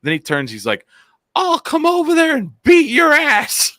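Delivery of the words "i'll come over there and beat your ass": loud, very high shouted threat; imitated threatening yell. Scooter rider threatening the screaming man, angry holler. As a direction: holler it as a threat across the street. Retelling a passerby's yelled threat.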